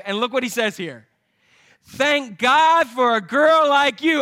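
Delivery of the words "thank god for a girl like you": slow, loud and high with delight; thrilled man thanking God for his bride. Retold or imitated. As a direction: savour every word, overjoyed. Boaz's delighted reply.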